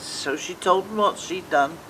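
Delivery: ordinary delivery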